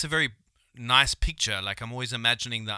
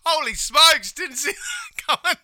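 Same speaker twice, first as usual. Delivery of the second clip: much higher, loud, laughing; excited Aussie presenter; 13 semitones higher, 8 dB louder than usual. Retold or imitated. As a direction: wildlife presenter surprised. Voicing Bobby Irwin surprised.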